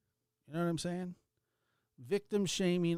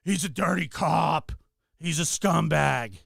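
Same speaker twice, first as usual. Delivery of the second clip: mock outrage; 6 dB louder than usual. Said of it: "and you go".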